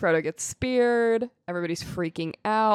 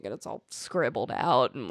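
normal talking voice

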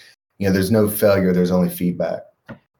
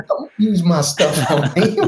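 much higher, slow, laughing mock protest; caught-out parent; 10 semitones higher, 3 dB louder, slower than usual. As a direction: whine it like a parent caught out by his kid. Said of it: "You're just like".

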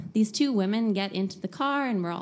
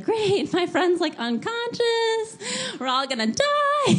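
high, louder mock panic; sarcastic doom; 13 semitones higher, 4 dB louder than usual. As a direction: drawl unconscious in mock despair. Friend asleep, mock doom.

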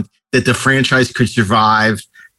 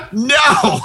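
high-pitched acted "no"; mock emphatic refusal; 12 semitones higher than usual. George Martin refusing other singers in a retold story, mock exasperation.

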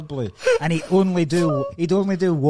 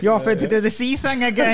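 higher, louder mock wife voice, laughing through it; imagined wife teasing; 7 semitones higher, 5 dB louder than usual. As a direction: tease him like an amused wife. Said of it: "Talking to his wife, going".

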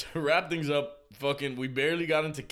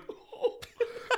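cry laugh. Retold or imitated. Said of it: "Feli does the cry laugh".

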